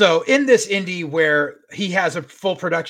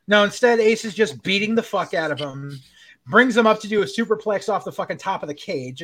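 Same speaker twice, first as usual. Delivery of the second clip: usual speaking voice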